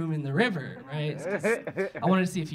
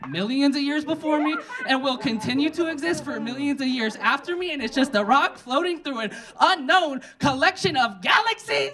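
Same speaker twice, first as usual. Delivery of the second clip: high, raised voice building to a shouted finish; frantic rant; 6 semitones higher, 4 dB louder than usual. Acted. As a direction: rant on without letup, peaking at the end. Escalating comic rant after the chant.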